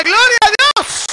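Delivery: high, loud, slow praise cry; loud drawn-out gloria; preaching shout. Shown as a demonstration. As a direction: lead the church in a shout. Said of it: "A ver, diga".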